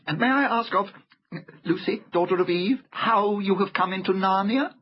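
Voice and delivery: high, courteous fawn voice; inquisitive polite fawn. A narrator's reading. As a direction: ask delicately as a fussy fawn.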